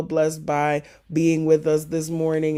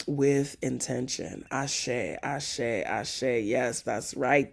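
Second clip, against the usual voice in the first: ordinary talk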